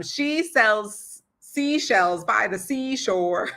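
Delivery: "she sells seashells by the seashore" spoken very slowly, every sound crisp; slowly over-articulate the twister; slow tongue twister. Shown as a demonstration. Coach demonstrating a tongue twister, slow and exaggerated. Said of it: "Doing tongue twisters is really good".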